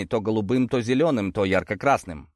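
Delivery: ordinary delivery, nothing marked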